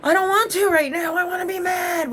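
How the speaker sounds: loud high shout, refusing to calm down; angry shout. Retold or imitated. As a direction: yell it back, wanting to stay mad. Her angry reply to daughter.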